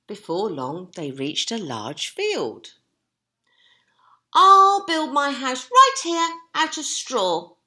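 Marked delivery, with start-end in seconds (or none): 4.3-7.6 high, loud cheerful piglet; cheering pig. Acted. Horace choosing straw, high loud cheer. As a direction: cheer it out in a squeaky voice. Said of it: "Horace cheered".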